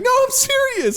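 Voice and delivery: very high mimicked insistence; mock-sincere protest; overly earnest. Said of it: "he's so earnest".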